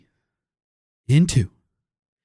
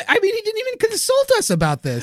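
high, whiny indignation; mock outrage. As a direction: complain in exaggerated outrage.

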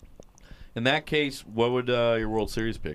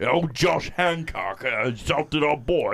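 slow, hammed-up British parody; mock British accent; 3 semitones higher, slower than usual. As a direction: draw it out in fake Cockney. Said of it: "Inbred British people".

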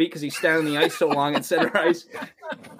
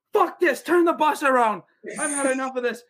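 fed-up coach yell; 9 semitones higher than usual. Retold or imitated. Coach ordering the bus turned around, fed-up yell. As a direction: stand up and bark the order.

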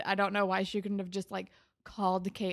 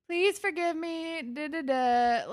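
a higher mock plea for forgiveness; lightly mock a begging boyfriend; pleading Craig; 8 semitones higher, 3 dB louder than usual. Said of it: "he's like".

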